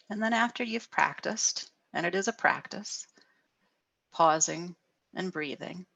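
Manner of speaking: ordinary delivery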